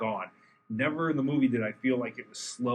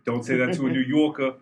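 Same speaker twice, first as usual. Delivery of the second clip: put-on loud, high, brash New York accent; 9 semitones higher, 8 dB louder than usual. Podcaster playing an indignant New Yorker, loud New York accent. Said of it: "That's my New York accent".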